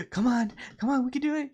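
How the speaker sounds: high-pitched imitation of an encouraging mother; coaxing mom. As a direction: urge a reluctant child on, high-voiced. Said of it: "trying to get his, her kid to".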